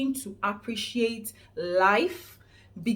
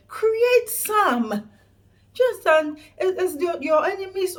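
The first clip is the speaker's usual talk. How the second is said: raised voice; 7 semitones higher, 6 dB louder, slower than usual. Real, not acted. Preaching self-help to viewers.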